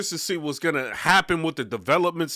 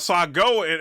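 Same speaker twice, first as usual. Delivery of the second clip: usual speaking voice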